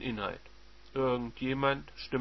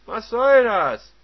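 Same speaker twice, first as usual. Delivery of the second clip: countering husband; 4 semitones higher, 9 dB louder than usual. Acted. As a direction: shut her down hard. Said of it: "konterte er".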